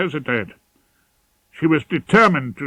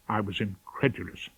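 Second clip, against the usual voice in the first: ordinary delivery, nothing marked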